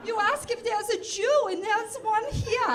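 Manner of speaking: put-on high, loud German accent.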